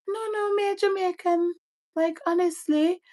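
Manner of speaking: high-pitched, sustained Jamaican patois. Retold or imitated. Telling a doubter she is Jamaican, in high-pitched patois. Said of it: "say it like that. I literally said to him".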